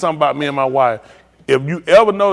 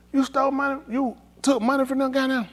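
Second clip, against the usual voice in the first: much higher, disbelieving question; shocked question; 10 semitones higher, 7 dB quieter than usual. Retold or imitated. Husband confronts wife about stolen money, high and shocked. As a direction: act stunned at the news.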